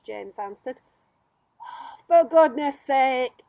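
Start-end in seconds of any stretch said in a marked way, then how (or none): 1.6-3.4 slow, loud, fed-up outburst; exasperated centipede. Acted. Centipede fed up with James and his boots, loud exasperated huff.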